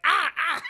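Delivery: high yelps, then very high laugh; doll yelps. Retold or imitated. Chucky yelps as Maggie bangs him into furniture. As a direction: squeal as he's knocked about.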